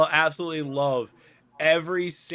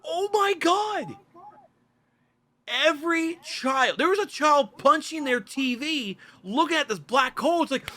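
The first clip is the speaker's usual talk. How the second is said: very high, animated disbelief; worked-up, squeaky-high storytelling; incredulous excitement; 12 semitones higher than usual. Real, not acted. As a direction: gush about it in a high, amazed pitch. Amazed retelling of crying-child videos.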